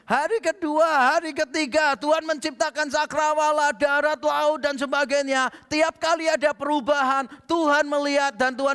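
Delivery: high, loud preacher's build; full-force sermon delivery; fiery preaching. Acted. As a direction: drive it home to the congregation.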